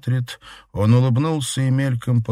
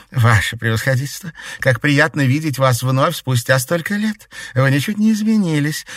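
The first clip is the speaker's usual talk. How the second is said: flattering witch; 7 semitones higher, 4 dB louder than usual. A narrator's reading.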